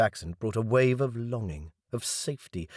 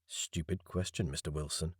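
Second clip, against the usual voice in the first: quiet, dry self-mockery; mock yourself under the breath; self-mocking aside; 4 semitones lower, 10 dB quieter than usual. A narrator's reading.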